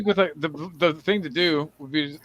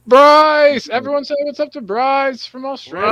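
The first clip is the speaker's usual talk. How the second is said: high, loud excited greeting; calling out; 10 semitones higher, 6 dB louder, slower than usual. Real, not acted. Host hails Bryce from Australia, calling out high. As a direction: shout the name, then announce high.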